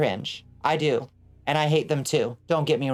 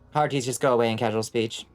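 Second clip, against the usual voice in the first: ordinary talk, nothing marked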